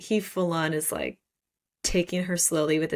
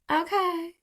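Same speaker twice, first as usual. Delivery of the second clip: mock swoon; 10 semitones higher than usual. Mock swooning reader reply.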